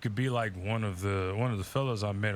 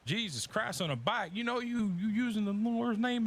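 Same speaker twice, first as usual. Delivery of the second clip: outraged churchgoer; 9 semitones higher than usual. Retold or imitated. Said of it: "they'd be like".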